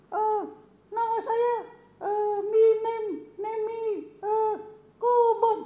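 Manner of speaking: put-on squeaky high child puppet voice; Kobon the puppet. Puppet Kobon greets the children, squeaky and high. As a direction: play a tiny excited puppet kid.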